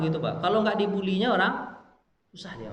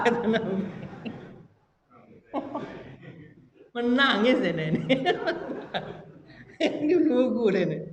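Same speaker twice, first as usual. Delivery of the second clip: laughing; 7 semitones higher than usual. Real, not acted. Laughs at the joke.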